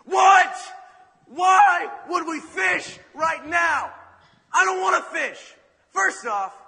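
high, loud indignant rant; exasperated outburst. Acted. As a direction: blow up at the carpenter, outraged. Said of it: "Peter reaches his tipping point".

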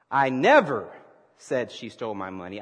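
"never" punched loud, pause after it; stress on never. Shown as a demonstration. Preacher practicing emphasis with the congregation, stress on "never". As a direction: hit "never" hard, let the rest drop. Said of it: "depending on the emphasis that you place".

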